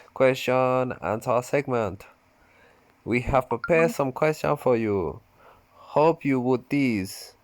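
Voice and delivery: playful hint of a Japanese accent. Shown as a demonstration. Reading the AMA intro in a put-on Japanese accent. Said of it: "I should say it in a Japanese accent".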